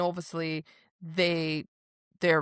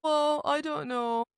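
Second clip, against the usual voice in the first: high, dopey mock Tamlin; clueless Tamlin; 9 semitones higher than usual. Mocking Tamlin's cluelessness to her co-host, high dopey voice. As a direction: play Tamlin as a clueless fool. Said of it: "He's just going".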